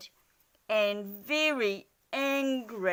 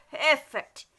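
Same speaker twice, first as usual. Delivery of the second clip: yawned; 4 semitones higher, 7 dB louder than usual. Acted. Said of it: "yawned Dormouse".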